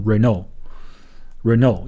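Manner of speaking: Renault slowly in English, reh-no, T silent; English pronunciation. Shown as a demonstration. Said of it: "应该读成". (英语读法 Renault)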